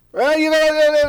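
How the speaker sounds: loud Ukrainian man giving unasked-for directions, boisterous. Retold or imitated. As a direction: boom it out like a helpful stranger. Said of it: "they talk really loud".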